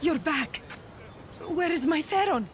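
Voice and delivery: high, urgent questioning; anxious mother. Acted. Mother asking rescuer about her captive son, anxious.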